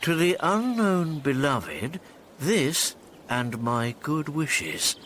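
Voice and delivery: normal talking voice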